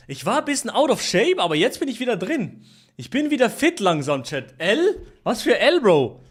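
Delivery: normal talking voice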